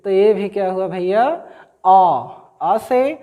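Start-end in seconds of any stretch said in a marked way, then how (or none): 1.9-2.8 long open "आ", no H; vowel without H. Shown as a demonstration. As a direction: hold "आ" as a pure starting vowel. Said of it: "इसको आप honourable नहीं कहेंगे".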